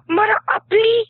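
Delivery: put-on high child voice copying the accent; copycat kid. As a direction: mimic the girl as a small boy would. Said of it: "and go".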